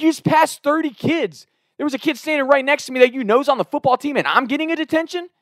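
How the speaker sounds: high-pitched indignant protest; losing it. Retold or imitated. Student protesting to the dean, high indignant outrage. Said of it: "I'll, I'll lose it".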